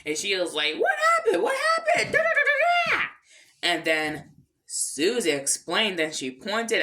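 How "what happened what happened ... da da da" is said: very high, loud squealed questioning; mock-frantic high cartoon voice; frantic teacher. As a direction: squeal as if trying to control the kids, trailing into gibberish. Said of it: "trying to console them and control the kids".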